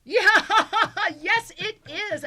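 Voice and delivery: high, loud laughing agreement; delighted laugh carried into the words. Real, not acted. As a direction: burst out laughing and agree through it. Laughs at guest's movie remark.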